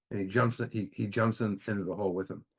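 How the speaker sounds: ordinary talk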